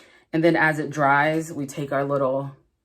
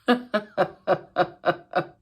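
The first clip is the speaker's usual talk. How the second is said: laughing; 4 semitones higher than usual. Real, not acted. Laughing at glitter mess.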